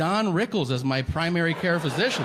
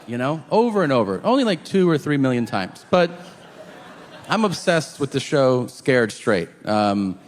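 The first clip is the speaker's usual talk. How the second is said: ordinary talk, nothing marked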